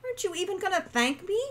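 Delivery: high, fast indignant question; indignant demand. A narrator's reading. Wren demanding thanks from Hawk, indignant.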